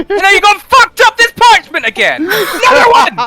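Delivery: angry laughing yell. Acted. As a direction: scold the child through laughter. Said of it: "Why did she lose her accent?".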